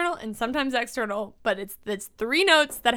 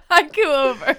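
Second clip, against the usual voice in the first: cracking up while talking; real helpless laughter; laughing; 10 semitones higher, 5 dB louder than usual. Real, not acted. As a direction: announce it through laughter. Cracking up at own haiku.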